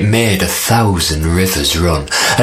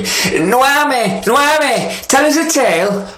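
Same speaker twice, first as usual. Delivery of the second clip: high pleading little spider voice; spider calling up; slower than usual. Acted. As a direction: call up high to the sky king. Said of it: "said in a voice not so twee".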